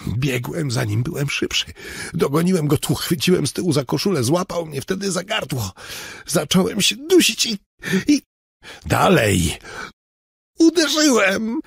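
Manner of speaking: breathless, high-pitched confession; frightened man recounting. Acted. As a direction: tell it in a rising panic. Robert retells the fight.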